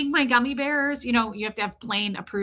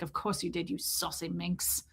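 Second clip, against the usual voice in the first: film character impression; 5 semitones lower, 11 dB quieter than usual. Film man teasing his partner, soft imitated line. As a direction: drop the voice way down, drawing out the end.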